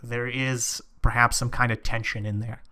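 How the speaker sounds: ordinary talk, nothing marked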